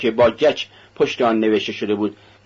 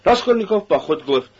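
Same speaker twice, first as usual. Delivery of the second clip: ordinary delivery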